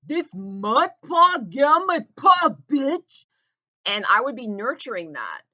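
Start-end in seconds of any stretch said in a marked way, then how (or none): 0.1-3.2 loud, much higher mock yell in a put-on thug voice; shouted caricature of the neighbor; mocking brutish neighbor. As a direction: ham up a dumb, aggressive lout barking at you. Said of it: "I would have been like".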